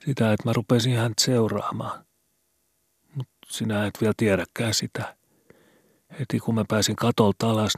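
put-on a scared boy asking in the dark; worried boy Skobe. A narrator's reading. Skobe confessing, higher. (huolestunut poika)

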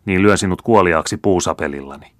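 ordinary delivery

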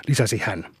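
ordinary delivery, nothing marked

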